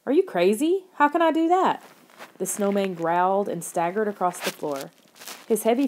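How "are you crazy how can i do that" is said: high-pitched, disbelieving retort; incredulous. A narrator's reading. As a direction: throw back an outraged question. Incredulous retort to Lauren's demand.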